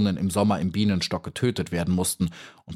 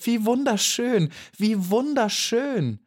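much higher, enthusiastic, slow; delighted cry; slower than usual. A narrator's reading. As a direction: gush with delight. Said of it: "rief ganz begeistert".

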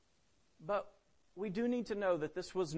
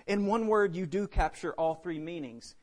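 ordinary delivery

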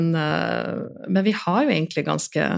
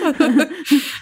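laugh; 9 semitones higher than usual. Real, not acted. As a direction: chuckle after the line. Laughs after the sentence.

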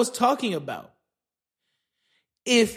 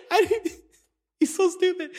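laughing; 8 semitones higher than usual. Real, not acted. Laughing at his own bit.

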